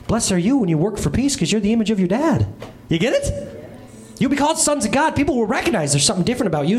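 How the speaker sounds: ordinary talk, nothing marked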